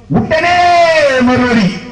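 high, long-drawn cry; exclaiming shout. Real, not acted. Preacher announcing the instant answer from the womb, exclaiming shout. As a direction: call it out high, stretching the first word.

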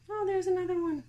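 high delighted coo; cooing discovery. Real, not acted. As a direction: greet the bug like a pet. Spotting another mite.

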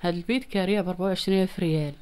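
normal talking voice

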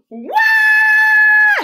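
high, loud fake bawling; the storyteller hams up Andrea's crying for the kids; mock toddler wail. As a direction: howl like a frustrated little girl. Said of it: "She would cry".

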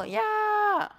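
high, sudden yelp of joy; excited squeal. Real, not acted. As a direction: squeal with delight. Excited on finding the spot.

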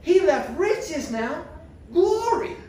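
preacher building to a climax for the congregation, soaring high; preaching climax. Acted. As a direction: lift it into a climactic preaching call.

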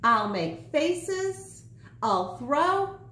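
put-on slow, high threat from a spoilt girl; a lightly voiced warning. A narrator's reading. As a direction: draw out the threat like a stubborn child. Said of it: "warned Pookins".

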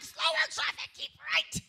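high-pitched exasperated yell; loud, shrieked mock road rage. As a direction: yell at the other drivers. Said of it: "I get road rage".

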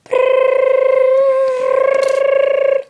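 long loud high shriek of laughter; shrill laugh burst. Acted. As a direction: cackle loudly and high.

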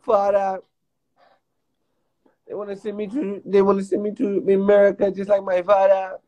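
a hint of an African accent pleading; mock African accent. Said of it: "talking your African voice".

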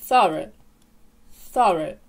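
thorough stressed first, ending in a short schwa; THOR-uh, relaxed short vowels in thorough; British pronunciation. Shown as a demonstration. Teacher puts the sounds together, British pronunciation. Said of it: "Разом у нас виходить".

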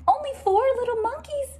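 high, quick alarmed mother; a worried mom counting fast; alarmed Mama. Acted. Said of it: "says Mama".

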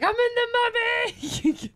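excited squeal. Retold or imitated. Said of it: "I was like".

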